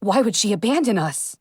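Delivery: plain reading voice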